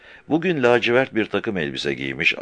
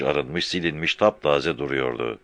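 normal talking voice

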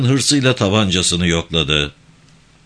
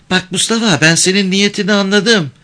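put-on high-pitched, pert girl's voice; high young voice standing up to a man; defiant village girl; 9 semitones higher than usual. A narrator's reading. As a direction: challenge him in a girl's high voice.

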